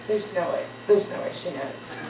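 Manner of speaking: low, soft, retold private dread; retold quiet denial; hushed disbelief. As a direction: say it under the voice, as if thinking it. Privately dreading that the teacher knows.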